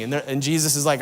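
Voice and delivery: ordinary talk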